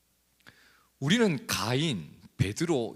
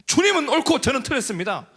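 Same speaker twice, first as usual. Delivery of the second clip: high, loud confession that the Lord is right; woman's tearful confession; 13 semitones higher, 8 dB louder than usual. Acted. Preacher voicing the woman confessing to the Lord, high and loud. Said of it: "그녀가 이 고백을 해요".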